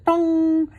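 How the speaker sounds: a high, loud tong; high tong sound effect. A narrator's reading. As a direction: ring it out like a bounce.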